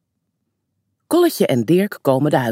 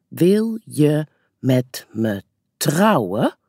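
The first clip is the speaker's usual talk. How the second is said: reading out slowly; much slower than usual. A narrator's reading. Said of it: "leest mama voor".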